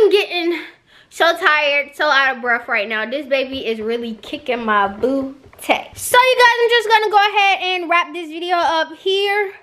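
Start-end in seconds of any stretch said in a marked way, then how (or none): none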